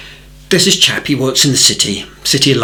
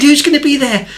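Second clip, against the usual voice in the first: much higher, eager voice; high excited question; 14 semitones higher, 3 dB louder than usual. Retold or imitated. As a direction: squeak the question out.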